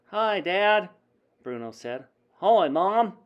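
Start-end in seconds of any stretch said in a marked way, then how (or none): 0.1-0.9 put-on higher, louder child's greeting; chirpy boy. A narrator's reading. Boy-mouse greets his parents. 2.4-3.2 put-on higher, louder child's greeting; chirpy boy. A narrator's reading. Boy-mouse greets his parents.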